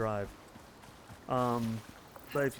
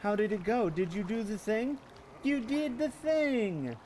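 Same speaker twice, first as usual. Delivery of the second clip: praising a pet; 6 semitones higher than usual. Acted. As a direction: gush over her like a puppy.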